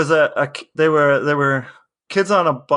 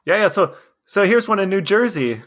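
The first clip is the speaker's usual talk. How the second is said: ordinary talk, nothing marked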